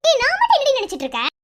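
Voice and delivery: high-pitched annoyed retort; indignant retort. Acted. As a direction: snap back at a friend in protest. Indignant retort to her friend. (கோபமாக, உயர்ந்த குரலில் எதிர்ப்பு)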